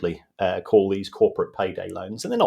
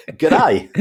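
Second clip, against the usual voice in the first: a hammed-up Australian accent G'day, higher and louder; bad Australian accent; 7 semitones higher, 8 dB louder than usual. Pundit does a bank's Australian greeting, appalling Australian accent. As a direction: greet like a mock Australian banker, badly. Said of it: "for that absolutely appalling".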